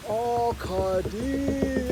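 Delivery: mild, drawn-out mock wail; old man's yell. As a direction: stretch the cry out slowly. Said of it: "yells the old man".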